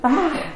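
high-pitched "Ah!" of alarm; mock panicked yelp. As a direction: yelp like a kid losing balance. Child realizes nobody holds the seat.

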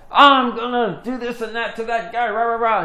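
loud, high mock rant; mock angry yelling. Rapper threatens the other guy, mock angry yelling. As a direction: imitate a furious man ranting. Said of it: "fighting and yelling and screaming and cussing".

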